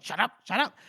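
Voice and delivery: high-pitched retold protest; mock panicked protest. Crawl trying to get away from Calder, mock panicked protest. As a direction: cut him off in a high, acted fluster. Acted quarrel, Crawl refusing to listen.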